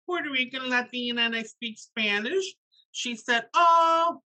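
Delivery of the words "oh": imitated high, loud "oh"; pleasantly surprised. Pediatrician assuming the boy is bilingual, imitated surprise. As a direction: exclaim brightly as if making an assumption. Pediatrician's reaction voiced in a story.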